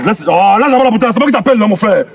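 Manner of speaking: ordinary talk, nothing marked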